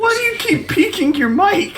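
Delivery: very high, laugh-choked question; cracking up while complaining; laughing complaint. Real, not acted. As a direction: squeal the question through laughter. Laughing complaint about the mic.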